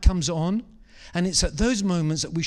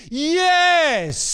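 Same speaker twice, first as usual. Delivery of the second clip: 1 ecstatic shout; 10 semitones higher, 6 dB louder than usual. Acted. Preacher voicing Mary's ecstatic yes.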